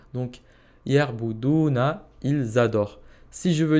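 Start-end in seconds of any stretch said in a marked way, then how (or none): 0.8-2.1 "yerboudouna" drawn out slowly, each syllable clear; slow word demo. Shown as a demonstration. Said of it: "Donc ya ou you plus una égale il".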